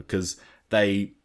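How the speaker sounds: ordinary talk, nothing marked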